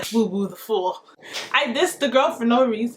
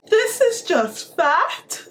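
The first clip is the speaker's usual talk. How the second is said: mock shocked; 7 semitones higher, much slower than usual. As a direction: drag out the shocked question, higher. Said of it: "we have to play that back".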